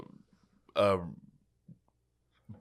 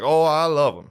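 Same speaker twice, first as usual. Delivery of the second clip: mock eager slave; 7 semitones higher, 6 dB louder than usual. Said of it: "He'd be like".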